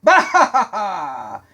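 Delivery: loud, high "bah-ha-ha" falling away; sudden booming gotcha cackle; blasted scare laugh. A narrator's reading. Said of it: "Jeremy blasted as he snuck up".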